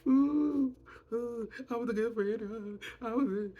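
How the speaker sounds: high, whiny mock sobbing; mock fake crying. Mocking a castmate's fake tears with a high whine. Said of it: "Lisa started fake crying".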